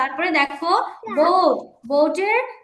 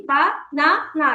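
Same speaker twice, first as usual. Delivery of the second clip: "বা না না" in three separate beats; split into syllables. Shown as a demonstration. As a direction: count out the syllables of "বা না না". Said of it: "আমরা তিন ভাগে উচ্চারণ করছি". ("বা না না" তিন ভাগে ভেঙে বলা)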